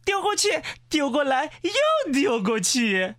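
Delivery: high-pitched excited commentary; excited pointing-out. Acted. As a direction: call everyone over to watch the action. Animal host points out spectacle.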